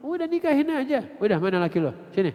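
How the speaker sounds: put-on father calling for daughter's boyfriend, impatient loud demand.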